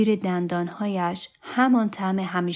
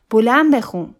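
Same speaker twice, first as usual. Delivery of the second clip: loud, higher-pitched demand; calling out; 4 semitones higher, 9 dB louder than usual. A narrator's reading. Guest demanding a song from him, calling out loudly. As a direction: call it out across the party.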